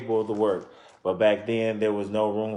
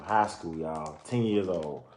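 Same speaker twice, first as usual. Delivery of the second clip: usual speaking voice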